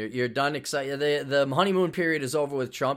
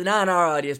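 ordinary talk

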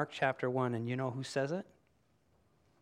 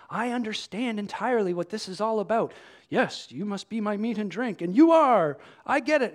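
raised-pitch show of total conviction; hammed-up keen follower, building louder and higher; mock eager; 7 semitones higher, 6 dB louder than usual. Imagined disciple claims to understand Jesus entirely, mock eager.

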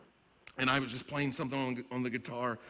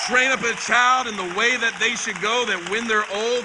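much louder and much higher, slowing down hard on the last line; full-force preaching; 9 semitones higher, 11 dB louder than usual. Acted. Preacher exhorting the parents in the church, at full force. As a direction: drive it home from the pulpit.

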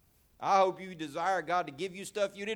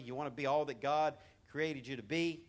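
ordinary delivery

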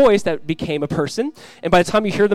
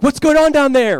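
loud, high yell from upstairs; mimicked parental shout; imitated parent's yell; 10 semitones higher, 9 dB louder than usual. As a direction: holler down the stairs like a fed-up parent. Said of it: "hear a voice from upstairs yell".

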